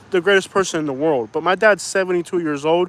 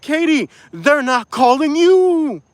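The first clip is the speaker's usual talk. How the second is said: higher, slow mock retort; quoting himself; 9 semitones higher, slower than usual. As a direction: replay his own retort in a raised voice. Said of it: "And I said".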